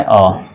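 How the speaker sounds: the short vowel अ for A; short a sound. Shown as a demonstration. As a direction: say अ as a short clipped a, not ए. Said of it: "ए को आपको बोलना है".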